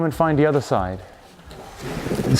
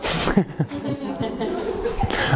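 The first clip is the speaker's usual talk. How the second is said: embarrassed giggle; 7 semitones higher, 4 dB quieter than usual. Real, not acted. Embarrassed about downward dog.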